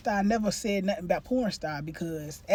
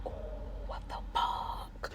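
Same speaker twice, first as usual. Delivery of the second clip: shocked whisper; 12 dB quieter than usual. Retold or imitated. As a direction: gasp it out under your breath. Quiet shocked reaction to discovery.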